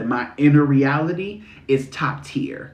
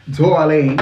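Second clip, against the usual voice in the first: New York darling; 2 semitones higher, 6 dB louder than usual. Shown as a demonstration. Trying out ways of saying darling.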